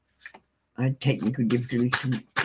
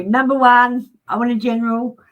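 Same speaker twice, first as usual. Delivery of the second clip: mock bossy client, louder and higher; demanding client; 8 semitones higher, 6 dB louder than usual. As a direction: put on a pushy customer voice giving orders. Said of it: "and you go".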